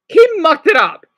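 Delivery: loud, high burst of outrage; high, punchy and outraged; indignant outburst. Real, not acted. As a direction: exclaim it loudly in indignation. Ranting about a botched surgery.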